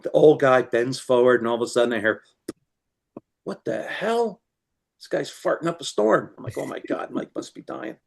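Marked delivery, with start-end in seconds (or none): 3.4-6.3 shocked outburst. Retold or imitated. Old guy reacts to fart noise. 6.6-8.0 hushed whisper to himself, trying not to laugh; whispered aside. Retold or imitated. Retelling the fart prank at the show, a whispered aside.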